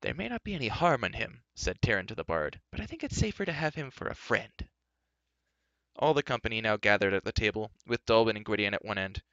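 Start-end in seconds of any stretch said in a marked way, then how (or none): 0.0-4.7 high-pitched youthful voice; young Taran, cautious. Acted. Youth confides to the bard about the king, cautious.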